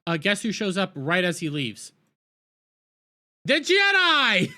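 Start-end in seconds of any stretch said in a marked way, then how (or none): 3.5-4.5 high, loud, slow exaggerated reveal; mocking shout. Real, not acted. Reviewer mocking the Jedi's instant arrival, shouting. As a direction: announce it like a ridiculous surprise.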